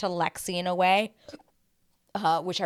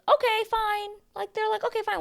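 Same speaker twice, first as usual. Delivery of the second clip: mock weak bachelor. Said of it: "bachelors are just like".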